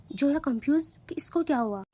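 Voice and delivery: usual speaking voice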